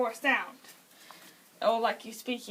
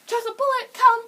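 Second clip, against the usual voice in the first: very high, loud summons; high, emphatic come-here call; pet command call; 10 semitones higher, 13 dB louder than usual. Shown as a demonstration. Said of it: "there's two commands I do".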